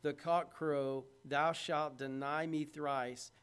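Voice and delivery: measured, slow King James reading; slow, solemn scripture voice; slow scripture quote. Retold or imitated. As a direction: read the Lord's words slowly. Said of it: "how he had said unto him".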